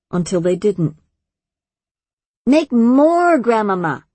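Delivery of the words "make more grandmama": put-on high, loud, eager little girl; excited child. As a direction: cry out like a delighted kid. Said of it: "Luna cried".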